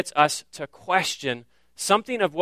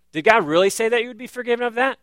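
quick higher taunting question; a hint of a sly tempter; voicing the serpent; 6 semitones higher, faster than usual. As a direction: needle them with doubt. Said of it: "serpent's doubt voiced".

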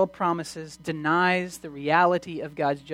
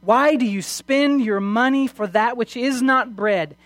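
impassioned preaching; 4 semitones higher, 4 dB louder than usual. Acted. Preacher offering God's free invitation to the congregation, impassioned. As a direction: proclaim it to the congregation with rising intensity. Preacher builds to full force.

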